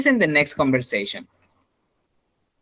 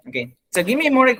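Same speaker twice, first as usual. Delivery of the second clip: ordinary talk, nothing marked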